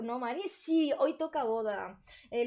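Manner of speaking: ordinary talk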